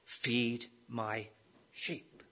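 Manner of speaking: "sheep" dropped to a whisper; fading to hush. Acted. Preacher voicing Jesus' last commission to Peter, trailing into a whisper. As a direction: let the words die away into a breath.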